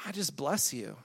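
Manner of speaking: soft, unpretentious blessing; gentle blessing. Shown as a demonstration. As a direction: say it gently over someone. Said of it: "You just say".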